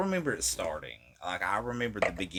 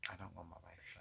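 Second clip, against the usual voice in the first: quiet echo; 20 dB quieter, much faster than usual. Real, not acted.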